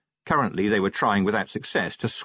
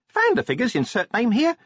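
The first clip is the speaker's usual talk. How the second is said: imp voice; 14 semitones higher, 3 dB louder than usual. Acted. Imp reports.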